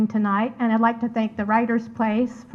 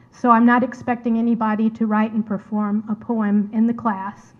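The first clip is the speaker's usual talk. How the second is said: normal talking voice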